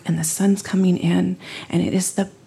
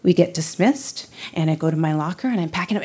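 usual speaking voice

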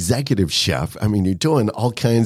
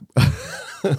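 laugh; 10 semitones higher than usual. Real, not acted. Laughing at own joke.

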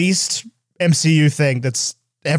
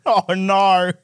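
higher, broad Australian "no"; Australian accent; 4 semitones higher than usual. Retold or imitated. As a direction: echo in a mock Australian accent.

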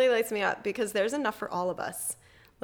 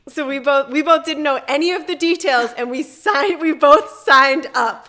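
excited; 5 semitones higher, 12 dB louder than usual. Real, not acted. Excitedly recounting signing up.

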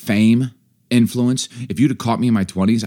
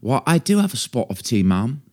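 higher-pitched mock British; British accent; 4 semitones higher than usual. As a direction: answer in a playful British accent. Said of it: "British accent talk off".

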